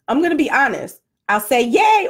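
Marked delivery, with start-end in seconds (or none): 1.6-2.1 high, louder little cheer; mock cheer. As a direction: give a put-on celebratory cheer. Imagined cheerful answer to friends.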